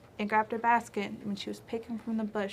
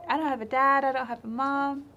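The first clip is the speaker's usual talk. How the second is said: chipmunk's reply; 4 semitones higher, 5 dB louder than usual. Acted. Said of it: "So Chipmunk says".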